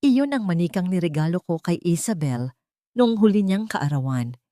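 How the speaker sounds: ordinary delivery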